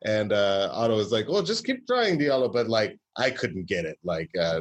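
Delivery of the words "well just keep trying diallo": mock German professor accent, pitched up; a hammed-up German accent; lightly done German professor voice. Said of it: "that was my best German accent".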